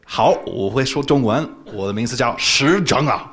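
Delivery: a confident Mandarin missionary greeting; speaking Mandarin. Shown as a demonstration. Showing the interviewer his missionary Mandarin, spoken proudly. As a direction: introduce yourself in Mandarin as the missionary. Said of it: "speaking Mandarin Chinese".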